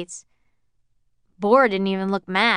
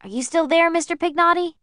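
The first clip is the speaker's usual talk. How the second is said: much higher, pleading question down the line; anxious, high-pitched; 10 semitones higher, 3 dB louder than usual. Acted. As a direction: beg him to answer. Anxious calling on phone.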